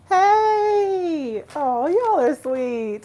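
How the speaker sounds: high, loud, delighted hello; slow, beaming, loud welcome; excited greeting. Real, not acted. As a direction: greet them with a burst of joy. Greeting arriving viewers.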